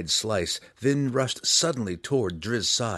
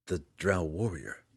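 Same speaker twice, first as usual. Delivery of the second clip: hushed, lower, halting reply; barely whispered; 3 semitones lower, 6 dB quieter than usual. A narrator's reading. Drizzt answering Belwar on seeing his father, barely whispering. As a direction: barely get the words out, softer. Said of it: "barely able to whisper".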